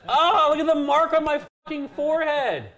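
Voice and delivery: loud, high protest; disgusted outcry. Real, not acted. Streamer reacting to a kiss mark on his forehead, grossed out. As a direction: cry out in high-pitched dismay. Grossed out after being kissed.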